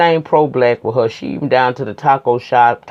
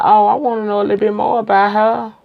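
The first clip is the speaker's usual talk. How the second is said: intrigued; 8 semitones higher, faster than usual. Retold or imitated. Reviewer reacting to the twin reveal, intrigued. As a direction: pitch it up with piqued interest. Acts out own curious reaction.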